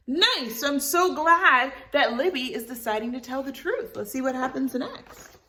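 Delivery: cheerful host aside. Acted. Host cheering Libby's choice to listening kids.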